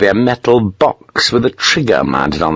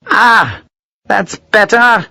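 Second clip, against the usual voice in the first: relieved; 11 semitones higher, much slower than usual. A narrator's reading. Colonel relieved after being bled.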